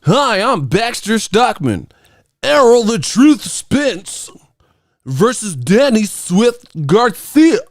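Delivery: very high, slow, prim broadcaster; mocking a too-clean boxing promo; square TV announcer. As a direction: announce it stiff and proper like a square sportscaster. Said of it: "talk boxing all square, Bryant Gumbel".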